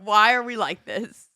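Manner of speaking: mock exasperation. Retold exasperated reaction to guy.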